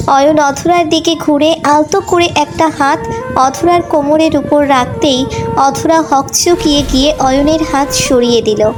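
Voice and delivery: ordinary delivery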